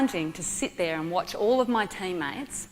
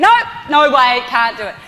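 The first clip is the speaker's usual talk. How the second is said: retold flat refusal in a loud burst; panicked refusal; 6 semitones higher, 13 dB louder than usual. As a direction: back out in sudden alarm. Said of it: "I just went".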